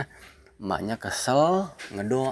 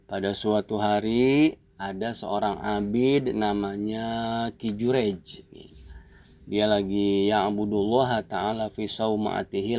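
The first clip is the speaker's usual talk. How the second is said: ordinary talk, nothing marked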